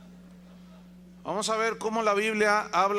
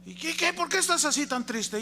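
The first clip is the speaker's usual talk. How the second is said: higher concerned asking voice; lightly voiced preacher; 9 semitones higher than usual. Preacher in the joke asking the sad devil, higher and concerned. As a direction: kindly ask what's wrong. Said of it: "story dialogue".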